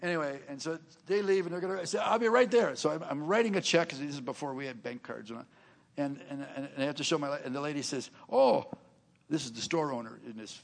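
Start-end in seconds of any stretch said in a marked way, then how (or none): 2.0-2.8 higher, louder call back; light sing-song reply from afar. Retold or imitated. Wife calling back from store. 8.3-8.6 high, loud surprised "Oh"; a bright startled exclamation. Retold or imitated. Store owner's surprised exclamation.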